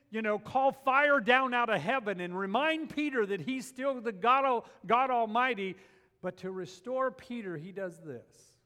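loud, high preaching build; rising sermon fervour; fiery preaching. Acted. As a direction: build it up with force. Preaching at full force.

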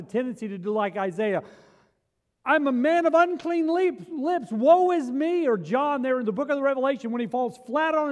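loud, high, impassioned sermon voice; preaching at full force. Acted. Pastor telling the healed woman's story, preaching loud. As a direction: preach it with mounting fire.

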